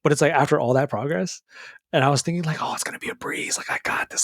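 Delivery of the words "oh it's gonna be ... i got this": breathy, voiceless self-talk; whisper. Retold or imitated. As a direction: whisper it like a secret thought. Past self's cocky inner thoughts.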